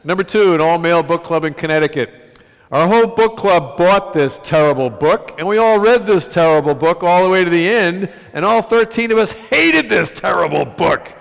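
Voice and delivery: loud, high announcer-style reading; list announcement. Acted. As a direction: announce the insults like a countdown host.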